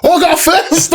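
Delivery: very high, loud burst of glee; hammed-up delighted realisation; mock elation. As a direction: squeal it loudly on the edge of laughter. Acts his delighted realisation.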